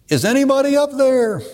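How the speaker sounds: high yell, calling upward. Retold or imitated. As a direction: call up desperately from the cliff. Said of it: "And so he yells".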